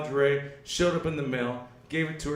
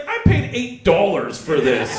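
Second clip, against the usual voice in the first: loud, slow comic protest; mock indignation; 6 dB louder, slower than usual. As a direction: stretch it out loudly, playing aggrieved. Acting out indignant protest about cost.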